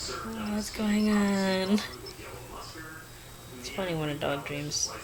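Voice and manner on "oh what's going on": sleepy dog voice. Retold or imitated. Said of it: "he woke up like".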